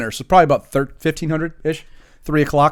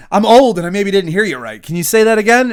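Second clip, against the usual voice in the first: higher, louder acted disbelief; mock incredulity; 6 semitones higher, 6 dB louder than usual. Bartender asks the customer to repeat his order, mock incredulous. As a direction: imitate a bartender pretending he misheard.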